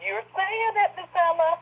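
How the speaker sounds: hyped. Real, not acted. Hyping the host's prediction.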